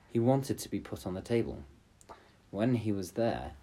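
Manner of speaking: usual speaking voice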